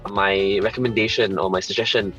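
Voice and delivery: normal talking voice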